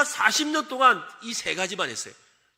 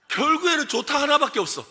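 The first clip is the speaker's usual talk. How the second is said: usual speaking voice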